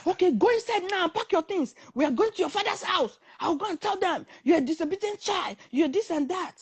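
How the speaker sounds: high-pitched, scolding commands; angry ordering. Retold or imitated. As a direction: imitate an angry man laying down orders. Retold man gives angry orders.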